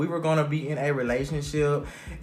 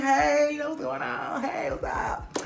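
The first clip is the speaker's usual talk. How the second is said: mock casual greeting; 9 semitones higher, 4 dB quieter, slower than usual. Greeting the ex and sister who pulled up, mock casual. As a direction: imitate an unbothered hello, trailing to a hush. Acts out greeting surprise visitors.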